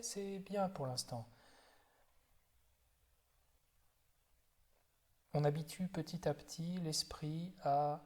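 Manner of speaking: calm, unhurried body-scan guidance with long silences; guided meditation. Real, not acted. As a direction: guide them gently through the body, leaving space after each phrase. (méditation guidée, voix calme et posée)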